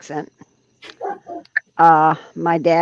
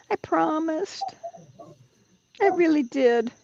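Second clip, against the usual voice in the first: teasing; 10 semitones higher, 5 dB quieter than usual. Pretend. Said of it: "Why are you teasing?".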